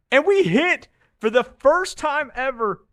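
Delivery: thrilled, high and loud brag; triumphant shout of a bettor who finally hit; excited. Real, not acted. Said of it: "bragging about winning parlay".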